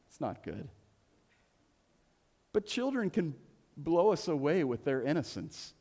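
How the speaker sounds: ordinary talk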